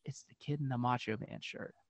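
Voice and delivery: hushed imitation of a whisper; imitated confidential whisper; whispered back. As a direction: pass it on secretly, very softly. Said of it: "the guy whispered back".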